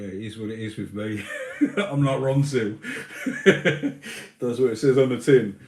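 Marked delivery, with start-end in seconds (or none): 1.6-5.6 laughing through the words; chuckling while joking. Real, not acted. Laughs at his own joke.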